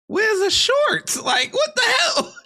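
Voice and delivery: high incredulous laughing shout; laughing disbelief. Real, not acted. Streamer reacts to bodycam clip.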